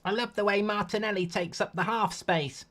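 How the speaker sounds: high, affected mock voice, pushed well up; a self-mocking high impression voice; cringy put-on impression. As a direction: put on a cringey fan voice and hold it. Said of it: "I'm putting this one on".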